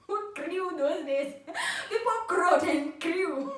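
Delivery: slow words that break into laughter; laughing. Real, not acted. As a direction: laugh your way through the line. Laughing retelling of crying.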